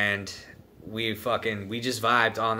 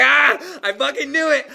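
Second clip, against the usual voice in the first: high, loud victorious shout; gloating loudly after landing the joke; triumphant gloat; 8 dB louder than usual. Retold or imitated. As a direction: crow it like you won. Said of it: "I was like".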